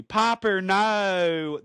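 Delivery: hammed-up Southern mom accent; Parker Posey. Shown as a demonstration. Podcaster showing the voice chosen for Rogue, exaggerated Southern accent.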